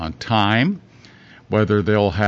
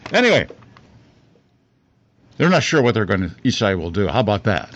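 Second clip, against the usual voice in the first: ordinary talk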